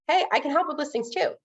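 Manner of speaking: high, upbeat sales pitch; eager pitch. Retold or imitated. Loan officer nudging a realtor: high eager pitch. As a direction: sell yourself to the realtor, eager. Reminding realtors about listings.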